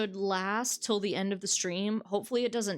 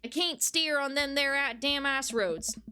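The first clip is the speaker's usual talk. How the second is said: higher hammed-up Southern accent, slowing at the end; 6 semitones higher than usual. Trucker twin cursing the haunted road, hammed-up Southern accent. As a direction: wail about the road in an overdone Southern accent. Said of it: "they're, they're southern".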